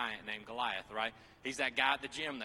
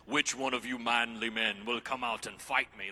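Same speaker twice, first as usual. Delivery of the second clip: giant's taunt; 3 semitones lower, 7 dB louder than usual. Acted. Voicing Goliath's taunt.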